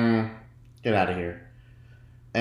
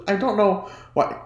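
high, put-on voice, laughing in; a character voice; 10 semitones higher than usual. Laughing as a goofy character takes over her voice. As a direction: let a silly character slip out. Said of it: "that character's coming out of me".

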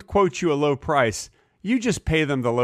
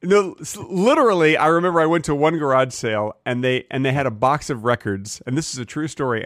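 normal talking voice

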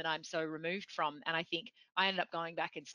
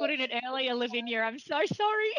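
high, loud, embarrassed apology through laughter; laughing apology; 7 semitones higher, 6 dB louder than usual. Real, not acted. Host apologising to guest for her gaffe, laughing. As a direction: apologise while cracking up, pitch climbing.

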